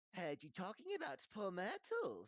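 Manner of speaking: high, loud taunting imp; mischievous poltergeist. A narrator's reading. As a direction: needle them in a high, loud impish voice. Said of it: "said Peeves".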